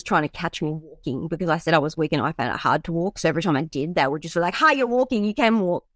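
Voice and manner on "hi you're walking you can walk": mock gotcha. Nurses catching her walking.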